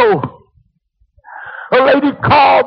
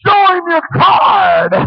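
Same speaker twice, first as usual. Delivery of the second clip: hollered; 4 semitones higher, slower than usual. Retold or imitated. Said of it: "the old farmer hollered and said".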